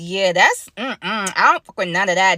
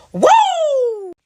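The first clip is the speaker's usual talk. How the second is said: gasp and whoop; 5 dB louder than usual. Real, not acted. Dramatic exclamation in story.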